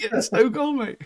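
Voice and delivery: high, thrilled retold answer; excited reply. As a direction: share the son's excitement, pitched right up. Retells excited reply to son.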